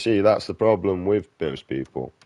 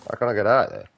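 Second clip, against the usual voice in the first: frustrated outburst; 6 semitones higher, 5 dB louder, faster than usual. Real, not acted. Gaming frustration.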